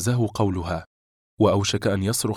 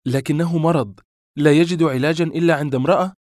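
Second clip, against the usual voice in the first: higher, louder cutting retort; sharp; 7 semitones higher, 5 dB louder than usual. A narrator's reading. Woman to her unfaithful lover, sharply. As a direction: turn on him sharply. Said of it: "فقالت بحدة لأول مرة".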